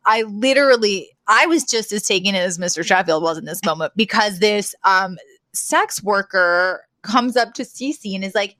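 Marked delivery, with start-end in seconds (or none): none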